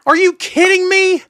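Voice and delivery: high, loud burst of disbelief; incredulous outburst. Real, not acted. Cohost reacting to the O J book story, incredulous.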